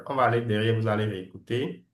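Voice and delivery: ordinary talk, nothing marked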